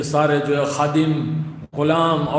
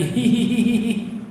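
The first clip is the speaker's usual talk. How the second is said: high, slow hee-hee giggle; a girl's teasing laugh, drawn out; giggling; 7 semitones higher, slower than usual. Retold or imitated. Slave girl giggling as the lash falls, high hee-hee. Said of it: "پھر ہنسنے لگی".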